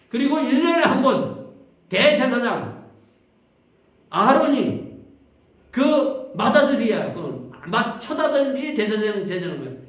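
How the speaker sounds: loud, high, proclaiming the high priest; preaching at full force. Real, not acted. Preacher proclaiming the yearly atonement to the congregation, loud and fervent. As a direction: ring it out over the congregation.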